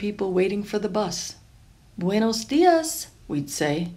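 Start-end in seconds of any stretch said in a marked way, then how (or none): 2.0-3.1 calling down greeting. A narrator's reading. Greeting people from the sky.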